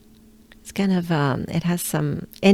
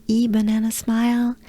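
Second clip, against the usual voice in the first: normal talking voice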